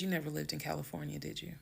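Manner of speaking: quick, hushed, composed challenge; calm. Retold or imitated. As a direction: put it to him softly, trailing off. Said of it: "I'm very calm".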